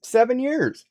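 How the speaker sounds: high, emphatic telling; animated. Real, not acted. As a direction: stretch the seven years for effect. Excited tale of old phone.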